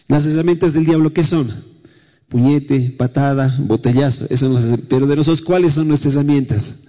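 ordinary delivery

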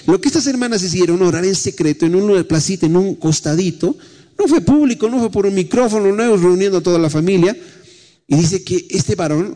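high, ringing preacher's voice held on every phrase; full-force pulpit preaching; fervent preaching. Acted. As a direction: preach it out to the congregation.

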